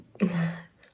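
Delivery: whispered laugh. Real, not acted. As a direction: laugh under your breath. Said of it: "Je parle tout bas".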